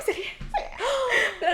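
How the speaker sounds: high, slow, laughing it off; laughing brush-off. Retold or imitated. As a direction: laugh through a nervous dismissal. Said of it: "J'étais comme".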